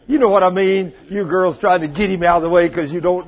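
ordinary delivery

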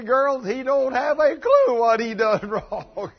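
loud, slow, laughing through it; preacher chuckling at the ladies. Real, not acted.

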